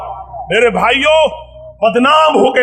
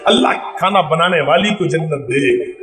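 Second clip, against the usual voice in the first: lower, fawning praise between bites; butter up the host; a hint of a fawning guest; flattering guest; 6 semitones lower than usual. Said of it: "बीच बीच में दुआ देता जाएगा".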